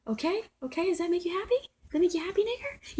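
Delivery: high, pushed-up mocking voice of the man; imitating his little voice. Vlogger mimics the neighbor taunting her, high and mocking. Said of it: "You heard his little voice".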